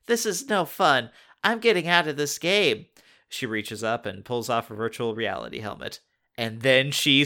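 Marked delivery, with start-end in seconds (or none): 0.0-2.8 whiny. A narrator's reading. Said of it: "Katie complains".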